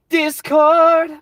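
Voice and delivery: DISCORD shouted high and loud; a playful sudden yell of Discord; excited shout. Pretend. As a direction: belt out Discord like an announcement.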